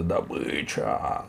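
drawn-out, held-back cry; robbers' mock yell. A narrator's reading. Robbers thrilled at the prey, understated slow yell. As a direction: stretch the word without raising volume. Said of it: "завопили они".

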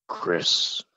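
soft unvoiced whisper of a name; ghost whisper. Ghost answering who the boss is, whispered. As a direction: breathe the name like a faint voice on tape.

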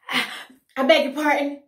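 loud, breathless indignation; shocked gasp. A narrator's reading. Fox gasping at being called a rat, loud and shocked. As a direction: sputter it out, scandalized. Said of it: "he gasped".

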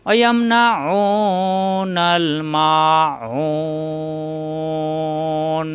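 slow, drawn-out chanted recitation; Quran recitation. Shown as a demonstration. Teacher reciting Quran verse for students, slow chant. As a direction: recite with long tajweed stretches.

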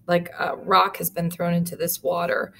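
ordinary delivery

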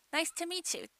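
"meet you" run together as mee-chu; linked meet you. Shown as a demonstration. Teacher quoting the phrase for learners, "meet you" linked. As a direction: join "meet" and "you" softly. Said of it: "一緒に発音してください".

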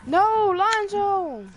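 high, loud, slow drawn-out cry; slow high shout of alarm; alarmed yell. Real, not acted. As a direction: yell to warn your teammate. Teammate yells at friend dying.

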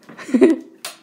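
loud high laugh; embarrassed laugh. Real, not acted.

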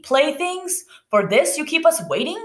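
high, indignant questioning; storybook outrage with pitch shooting up; angry grumbling. A narrator's reading. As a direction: complain in disbelief, as read aloud. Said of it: "the women grumbled angrily".

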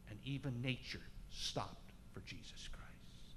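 slow hushed whisper; awed whisper. Real, not acted. Preacher whispering to the congregation about the stilled storm, hushed. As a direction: breathe it out in awe.

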